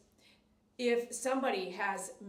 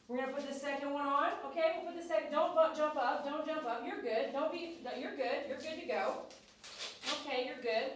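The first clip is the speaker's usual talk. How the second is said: higher, gentle pet-talk reassurance; lightly raised sing-song dog coaxing; coaxing a nervous dog; 3 semitones higher than usual. Real, not acted. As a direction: soothe the dog into cooperating. Coaxing the dog while grooming.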